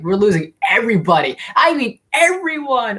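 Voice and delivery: rising into a high, stretched-out outcry; retold disbelief. Moviegoer recalls losing favorite heroes, in escalating disbelief. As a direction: act out stunned protest, pitch leaping at the end.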